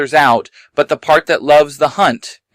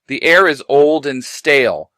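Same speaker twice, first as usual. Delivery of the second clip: ordinary delivery, nothing marked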